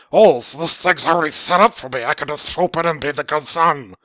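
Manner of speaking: loud, high put-on voice; hammed-up caricature of the good son; mocking son voice. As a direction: mimic an obnoxious son, scornfully. Mocking the son's imagined thoughts.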